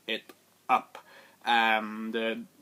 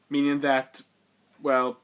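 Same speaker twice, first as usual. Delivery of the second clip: ordinary talk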